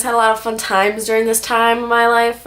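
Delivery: ordinary delivery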